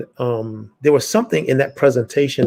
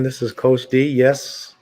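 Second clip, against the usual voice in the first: ordinary talk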